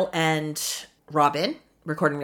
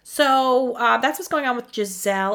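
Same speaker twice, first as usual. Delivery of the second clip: ordinary talk, nothing marked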